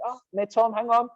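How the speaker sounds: a fond, high mimic of a Swiss French accent; cute Swiss French accent. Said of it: "really cute sort of Swiss French accent".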